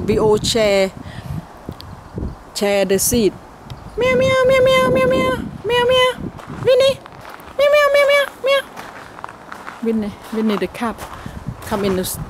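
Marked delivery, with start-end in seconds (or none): 4.0-8.6 high-pitched meowing to call the cat; high cat meows. Real, not acted. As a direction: mew like a cat to lure her over. Calling the cat in garden.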